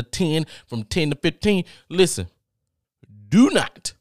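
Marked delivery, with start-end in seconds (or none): 3.3-3.9 high, loud DO NOT; loud warning. Acted. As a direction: shout the warning out. Urgent warning to viewers.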